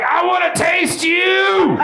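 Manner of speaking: high, slow, drawn-out mock scream at the intruder; hammed-up horror-movie yell, stretched out; crazed yell. Comic telling the crowd to scream at a burglar, crazed mock yell. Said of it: "Yell something like".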